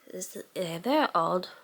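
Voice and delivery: usual speaking voice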